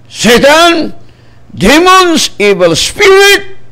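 high, loud shout at the spirits, evil spirit slow; commanding rebuke. Real, not acted. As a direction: cast them out with full force. Rebuking demons in prayer.

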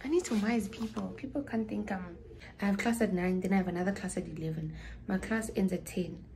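ordinary delivery

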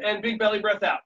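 ordinary delivery

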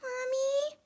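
very high, tiny questioning squeak; small high canary voice; little bird voice. A narrator's reading. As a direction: ask like a small child caught hiding. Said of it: "said Jeremy".

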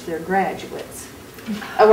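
ordinary delivery

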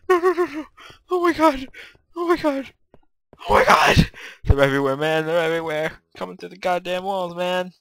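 loud, squealing panicked cries; high-pitched panic. Real, not acted. Gamer swarmed through the walls, high panicked yelling. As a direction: cry out as if completely overrun. Enemies coming from everywhere.

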